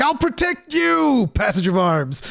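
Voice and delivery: high, slow, mock-gallant vow; hammed-up hero. Joking to co-host about shielding the party, high mock-heroic vow. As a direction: play the noble knight, tongue in cheek. Hammy heroic tank call.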